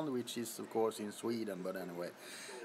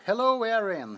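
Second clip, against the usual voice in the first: excited greeting; 6 semitones higher, 7 dB louder than usual. Real, not acted. Greeting viewer twice.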